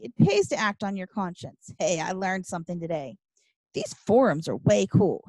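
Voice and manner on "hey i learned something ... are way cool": usual speaking voice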